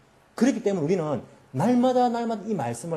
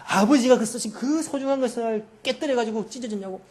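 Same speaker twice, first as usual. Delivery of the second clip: raised, higher-pitched mock telling-off; angry scolding; 6 semitones higher than usual. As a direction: act out an eldest brother scolding his juniors. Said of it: "막 뭐라 하는 거예요".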